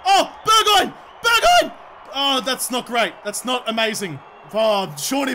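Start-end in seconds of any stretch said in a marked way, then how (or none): 0.1-0.9 high, loud cry of the player's name; excited call. Real, not acted. Calling to player shooting. 1.2-1.8 very high, loud squeal of the name, rising at the end; thrilled shriek. Real, not acted. Second, higher call to player.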